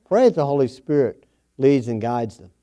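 ordinary talk

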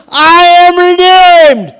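loud, high, drawn-out shout; bold declaration. Shown as a demonstration. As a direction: proclaim it boldly at full voice. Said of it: "Say it boldly".

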